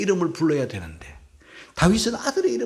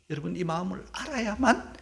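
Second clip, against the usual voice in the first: ordinary delivery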